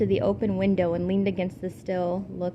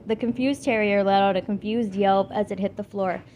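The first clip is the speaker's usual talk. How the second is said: plain reading voice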